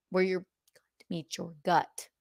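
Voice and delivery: catty whispered aside; whispering. Real, not acted. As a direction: whisper it like gossip. Catty aside about the dress.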